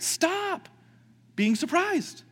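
high, firm "stop", then "being surprised"; firm command. Acted. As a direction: press the word "stop" home. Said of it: "And so John is saying".

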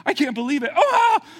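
high, loud, freaking-out voice; panicky Christians. Acted. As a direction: squeal like someone shocked all over again. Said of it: "Like they keep".

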